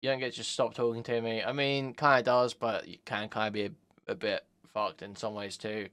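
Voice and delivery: ordinary delivery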